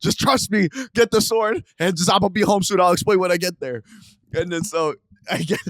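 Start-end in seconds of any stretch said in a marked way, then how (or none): 0.0-3.8 high, raised-voice urgency; urgent insistence. Retold or imitated. Telling brother to trust them on the drive home, urgent. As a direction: insist loudly, pitched well up.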